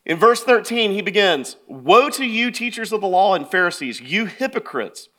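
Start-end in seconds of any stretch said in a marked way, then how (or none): 1.6-5.1 raised, forceful scripture reading; high, loud opening denunciation; in-their-face rebuke. Acted. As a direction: launch the accusation, then land it firmly. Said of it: "Jesus gets in their face".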